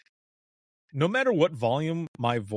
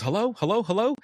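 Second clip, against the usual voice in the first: higher-pitched, questioning hellos, lightly imitated; puzzled caller; 9 semitones higher than usual. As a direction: imitate a caller checking if anyone's there. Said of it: "it kinda tricks the person to think".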